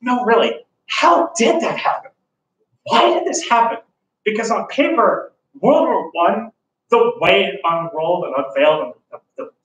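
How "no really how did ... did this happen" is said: bewildered looking back. Retold or imitated. Teacher voicing the class's hindsight, mock baffled. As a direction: voice the puzzled hindsight, pitched up. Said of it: "you look back and go".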